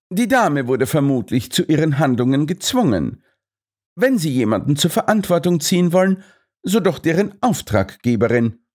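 high, brisk admonishing; reasoning Holmes. A narrator's reading. Holmes calls Overgrunge to order, high and brisk. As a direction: talk him down briskly.

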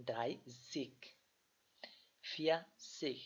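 "দ্রাই জিক" with a clear zig ending; adding zig. Shown as a demonstration. Teacher shows the tens ending to students, clearly. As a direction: tack "জিক" onto each number. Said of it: "একটা জিক লাগিয়ে দেবো".

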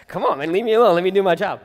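high, loud, fed-up brush-off; mock exasperated John. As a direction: play an annoyed prophet swatting away pestering questions. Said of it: "Like, twenty questions, bro".

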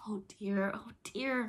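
mildly exasperated sighing lament; exasperated cry. A narrator's reading. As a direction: lament like a worn-out mother. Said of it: "cried Wendy".

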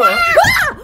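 high, loud startled shriek; mock fright scream. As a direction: jump out of your skin, playful. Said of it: "you scared me".

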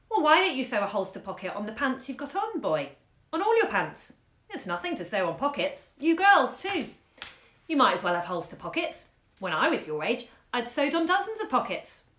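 put-on bouncy big-brother teasing the kids; jolly young man ribbing the children; college student.

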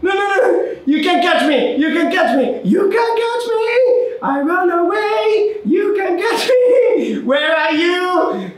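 high frantic shouted taunts; being chased. Real, not acted. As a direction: yell taunts while running away.

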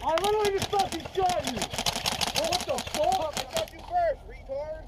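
furious high yelling; screaming at a teammate in rage; raging gamer. Real, not acted. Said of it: "hey, calm down".

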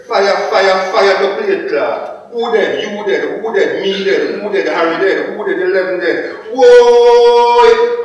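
high, urgent calls building to a long wail; dramatised panic in Jamaican patois; alarmed outcry. Acted. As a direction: cry the alarm, then wail at full voice. Acts out panicked cries at fire.

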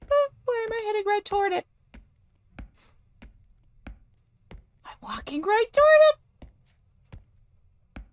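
very high falsetto dread; high-pitched nervous whine; nervous squeal. Acted. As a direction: squeak it out as you creep closer. Walking toward the threat.

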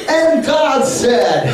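high, slow, booming pulpit voice; over-the-top sermon voice aimed at one kid; hammed-up preaching. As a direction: stretch it out like a mock-grand preacher. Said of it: "I preach to him almost like this".

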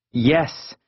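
ordinary delivery, nothing marked

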